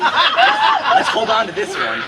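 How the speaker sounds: a squeaky high laugh on the first word, then a giggling rush; laughing excitement. Retold or imitated. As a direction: kids giddy over a new friend. Kids' excited plan, laughing.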